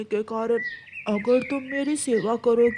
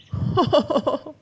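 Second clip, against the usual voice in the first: gloating laugh; mocking laugh; 2 semitones higher than usual. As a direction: sneer through a laugh. Green tree gloats again.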